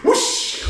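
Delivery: whoosh sound. Pretend. Imitating La Llorona's speed.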